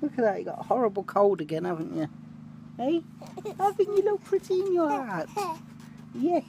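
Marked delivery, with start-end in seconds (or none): 2.8-6.4 high sing-song coo. Real, not acted. Cooing at Chloe.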